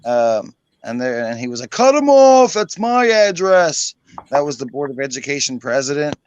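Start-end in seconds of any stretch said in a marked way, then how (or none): 1.7-3.9 high, loud indignant cry, then a slow insistent line; mock outraged shout. Board president yelling to cut the caller off, mock outraged shout. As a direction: shriek like a furious official. President cutting him off, retold.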